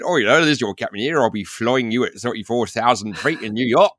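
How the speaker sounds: mock West Country pilot announcement; West Country captain. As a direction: airline captain with a broad Somerset burr. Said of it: "from Somerset and Wiltshire aren't taken".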